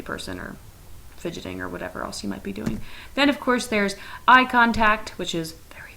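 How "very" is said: whisper. Pretend. Teacher drops to whisper for emphasis.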